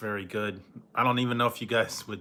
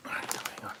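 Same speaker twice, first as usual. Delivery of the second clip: whisper; 11 dB quieter, faster than usual. Real, not acted. Whispering after coming back.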